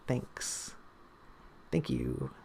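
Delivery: breathy whispered thank you; a soft unvoiced thanks. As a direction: whisper it like an aside to yourself.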